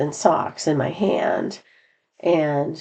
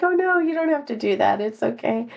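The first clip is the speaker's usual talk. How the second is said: surprised protest; 6 semitones higher than usual. Retold or imitated. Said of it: "and I'm like".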